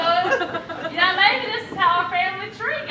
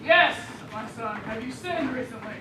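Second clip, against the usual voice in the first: put-on low, soft priest voice; lower voice; 8 semitones lower, 6 dB quieter than usual. Wife poses as pastor in confessional, lowered voice. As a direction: drop low and play the confessor. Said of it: "like my wife with a lower voice".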